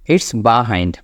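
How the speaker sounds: "behind" as "buh-hind", said low; corrected pronunciation. Shown as a demonstration. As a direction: open buh at the start of "behind". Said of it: "বয়াকার দিয়ে উচ্চারণটা করবেন". ("behind"-এর শুরুতে বয়াকার, "বাহাইন্ড")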